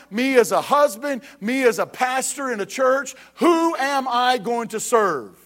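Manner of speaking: rising, emphatic preacher's cry with slow questions; preaching at full force. Acted. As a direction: press the congregation hard with each question. Builds preaching intensity.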